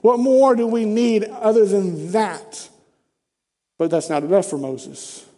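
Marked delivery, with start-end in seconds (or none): none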